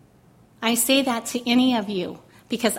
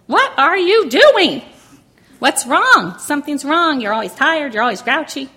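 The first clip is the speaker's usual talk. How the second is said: louder, higher, angry yell at her husband; retold yelling; 5 semitones higher, 10 dB louder than usual. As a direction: yell it out in frustration. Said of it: "Sometimes I would scream and yell".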